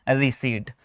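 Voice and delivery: रिसीट with silent p; low, soft रिसीट, long ee into t; right pronunciation. Shown as a demonstration. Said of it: "right answer क्या होगा". (रिसीट, 'प' चुप रखकर)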